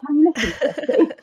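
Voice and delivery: high-pitched, giggly request; laughing mock plea. Host jokes about sending him the episode, laughing mock plea. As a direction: ask it pleadingly through laughter.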